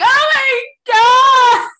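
loud, high, drawn-out mock sobbing wail; crying fan. Fans meeting Elphaba start crying, exaggerated loud wail. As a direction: bawl it slowly, hammed-up. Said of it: "They start crying".

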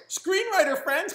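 high, loud incredulous echo; surprised. Retold or imitated. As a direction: squeak it out in disbelief. Surprised at the invitation.

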